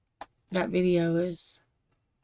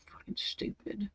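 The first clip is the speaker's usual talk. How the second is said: whispered curse; 16 dB quieter than usual. Real, not acted. Exasperated rant, whispered curse.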